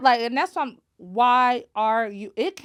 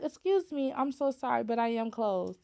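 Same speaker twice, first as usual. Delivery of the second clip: softer, courteous model apology; polite apology; 6 dB quieter than usual. Shown as a demonstration. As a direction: show how to turn a customer away kindly. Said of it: "Why couldn't you say".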